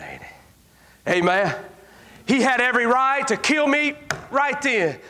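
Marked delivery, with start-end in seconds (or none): 0.0-1.1 whisper. Acted. Preacher's aside to congregation.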